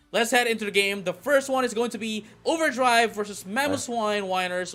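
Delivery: loud, high, excited announcer voice; hyped esports announcer. Acted. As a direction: sell the matchup like a big arena caster. Hyped match intro.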